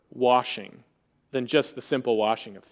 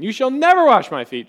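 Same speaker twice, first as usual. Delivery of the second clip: higher, louder blurt hammering never; 8 semitones higher, 6 dB louder than usual. Acted. Peter refusing Jesus, high loud blurt. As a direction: shoot it out before thinking. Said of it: "immediate thing that comes to his mind".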